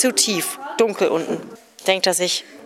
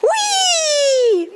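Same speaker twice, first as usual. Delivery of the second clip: shrill high whoop, much louder; 5 dB louder than usual. Acted. Kid whooping before telling a joke. Excited shriek at joke start.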